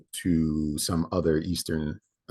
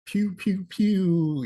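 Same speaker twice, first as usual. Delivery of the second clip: high whistling pew pew; firework voice; 13 semitones higher, much slower than usual. Shown as a demonstration. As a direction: squeal out fireworks shooting. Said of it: "that's my firework voice".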